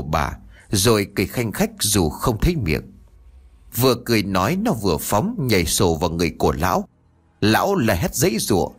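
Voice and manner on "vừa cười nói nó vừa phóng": ordinary delivery, nothing marked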